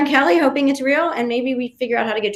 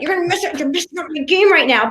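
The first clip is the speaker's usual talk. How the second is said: higher-pitched mock accent; Gavin; 6 semitones higher than usual. Castaway scolding her for talking in public, lightly imitated accent. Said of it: "the accent".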